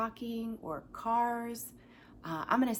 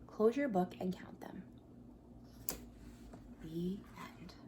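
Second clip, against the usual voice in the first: whisper; 7 dB quieter than usual. Acted. Whispering the book's ending.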